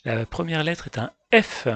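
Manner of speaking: normal talking voice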